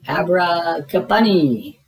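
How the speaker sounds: slow, drawn-out magic word; magic spell. Acted. Mickey casting the magic word, drawn out. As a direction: cast the spell grandly.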